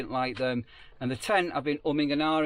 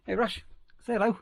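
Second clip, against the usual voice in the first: higher, sing-song dog talk; 7 semitones higher than usual. Real, not acted. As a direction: coax the dog like a pet owner. Coaxing his dog to greet.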